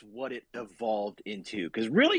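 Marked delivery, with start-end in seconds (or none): 1.9-2.2 voice cracking. Real, not acted. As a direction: voice cracks high on really. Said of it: "my voice just cracked".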